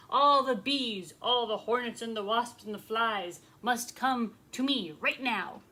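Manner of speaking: loud, punched royal order; furious command. Acted. King orders all the insects to come, furious command. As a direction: hammer out each group like an angry king. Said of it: "he commanded with fury".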